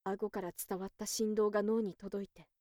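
plain reading voice

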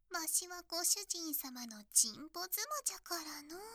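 ordinary delivery

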